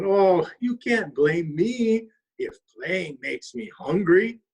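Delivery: slow, sly raccoon shrugging off the blame. Acted. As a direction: drag it out with a guilty grin. Raccoon admits his trick.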